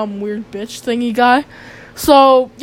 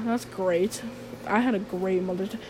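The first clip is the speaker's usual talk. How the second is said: sarcastic; 5 semitones lower, 11 dB quieter than usual. Real, not acted. Sarcastic about bad day.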